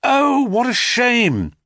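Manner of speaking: mock regret. A narrator's reading. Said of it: "cried Phaeton".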